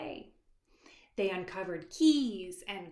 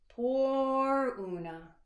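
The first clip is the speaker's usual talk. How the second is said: slow, higher, louder sympathetic sigh; mock pity; 5 semitones higher, 5 dB louder, much slower than usual. A narrator's reading. Narrator feeling sorry for Una. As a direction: drag it out with pity.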